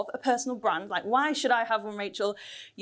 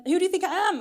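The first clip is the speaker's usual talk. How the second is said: higher, affronted supermarket retort; mock indignation; 7 semitones higher, 3 dB louder, much faster than usual. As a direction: act offended, pitching it up. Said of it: "They're really rude".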